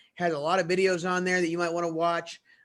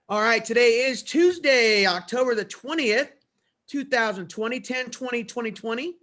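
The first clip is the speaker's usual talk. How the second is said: louder, higher announcement read; announcer voice; 6 semitones higher, 5 dB louder than usual. Acted. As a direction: read it like school morning announcements. Opens the morning announcements.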